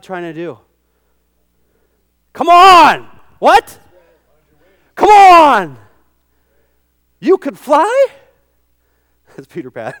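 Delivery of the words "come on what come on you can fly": loud, high rousing calls; rally the crowd; fired up. Real, not acted. Preacher rousing the congregation, loud and excited.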